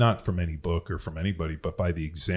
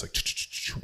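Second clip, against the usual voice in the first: unvoiced ch-ch-ch mimicking keys; soft rapid typewriter noise; imitated typewriter clatter; 5 dB quieter than usual. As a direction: click out the typewriter sound with your mouth. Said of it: "I hear this".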